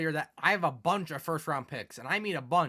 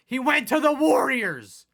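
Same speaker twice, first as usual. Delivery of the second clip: much higher, louder, slower outburst; outraged yell; 9 semitones higher, 10 dB louder, slower than usual. Real, not acted. Gamer repeats the loss, now yelling. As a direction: shout it at the screen.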